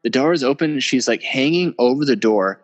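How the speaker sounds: ordinary talk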